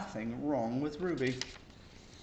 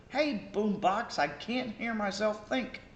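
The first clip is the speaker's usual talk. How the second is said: higher, louder complaining student; annoyed shout; 9 semitones higher, 4 dB louder than usual. Acted. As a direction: yell up at the noisy kid. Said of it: "The student on the middle floor shouted".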